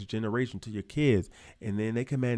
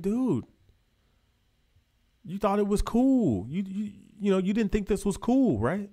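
mock scolding; 8 semitones higher than usual. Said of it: "So I'm like".

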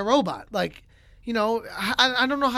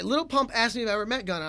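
usual speaking voice

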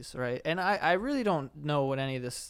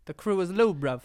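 mock London; 3 semitones higher, 4 dB louder than usual. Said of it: "It does sound, like, very London".

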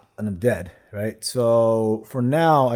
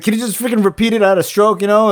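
exasperated demand; 8 semitones higher, 8 dB louder than usual. Retold or imitated. Retold demand to the clerk.

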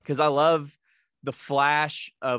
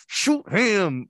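higher, louder, gritted-teeth dare; Debo through gritted teeth; 8 semitones higher, 5 dB louder than usual. Retold or imitated. Friend quoting Debo daring Craig's mom, gritted teeth. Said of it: "with his teeth gritted".

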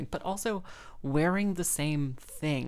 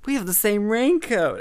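excited announcement; 6 semitones higher, 7 dB louder than usual. Retold or imitated. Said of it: "I was like".

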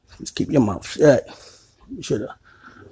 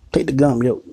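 usual speaking voice

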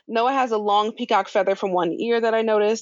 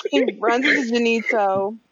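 ordinary talk

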